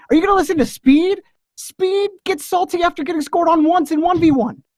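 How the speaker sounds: high, fast, fired-up defense; impassioned plea. Real, not acted. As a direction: pour it on like a courtroom plea. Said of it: "the impassioned man".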